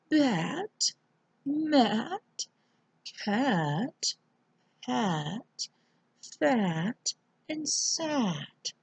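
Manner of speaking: soft, swooping fancy voice. Shown as a demonstration. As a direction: read each word softly with fancy, sweeping pitch. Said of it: "in a real fancy voice".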